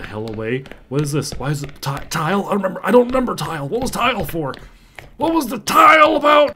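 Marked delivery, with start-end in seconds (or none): 2.8-6.5 high, loud confused yell; shout. Real, not acted. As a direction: bellow the question in alarm. Confused and scared by monster.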